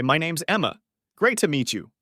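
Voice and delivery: normal talking voice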